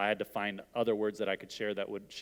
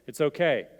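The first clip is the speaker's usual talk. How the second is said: firmer comfort; 3 semitones higher, 6 dB louder than usual. Shown as a demonstration. Pastor voicing a comforter's words, louder and firmer. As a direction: say it again louder, more insistent. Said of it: "we're gonna get through this".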